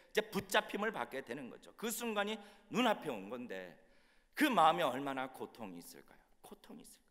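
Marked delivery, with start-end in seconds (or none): 4.3-6.2 full-voiced question with a falling end; emphatic rhetorical question. Question asked, then echoed softly. 6.4-7.0 very soft, whispered question; hushed echo. Acted. Same question echoed softly.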